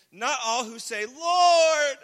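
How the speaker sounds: rising to a loud, high, drawn-out cry on "Lord"; shouted plea to the Lord; building to a shout. Shown as a demonstration. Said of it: "usually meant to become louder".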